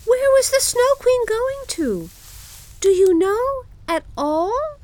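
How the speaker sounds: put-on very high, loud girl voice; Gerda's urgent question. A narrator's reading. Gerda asks pigeons where Kai went, crying out. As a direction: ask it high and pleading. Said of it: "cried Gerda".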